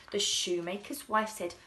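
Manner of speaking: plain reading voice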